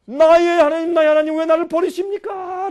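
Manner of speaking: loud anguished cry to God, voice high. Retold or imitated. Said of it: "라고 소리를 질렀으니까". (높고 크게 울부짖듯 외치기)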